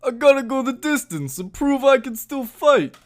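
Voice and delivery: high, loud mumbled Rocky voice; Stallone impression. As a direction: play an old boxer, gargling marbles. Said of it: "who has the better Stallone impression".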